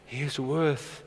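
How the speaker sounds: very soft, low murmur after singing; hushed reverence. Real, not acted. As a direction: let it drop almost to nothing. Hushed close after the worship song.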